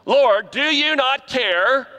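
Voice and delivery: high, slow, whiny complaint; pity party whine. Retold or imitated. Martha's pity party at Jesus, slow high whine. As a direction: whine it like a martyr. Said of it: "here comes Martha the pity party".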